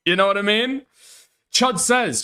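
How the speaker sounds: normal talking voice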